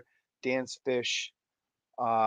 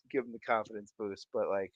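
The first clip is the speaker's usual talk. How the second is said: usual speaking voice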